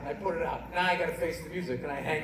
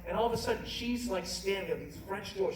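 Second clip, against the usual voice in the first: ordinary talk, nothing marked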